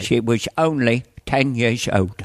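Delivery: slow, grave Cockney impression; Michael Caine. Shown as a demonstration. Comedian showing the actor's downward delivery, slow Cockney. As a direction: drop it down, drawn out. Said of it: "He goes down like this".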